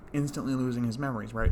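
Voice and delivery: normal talking voice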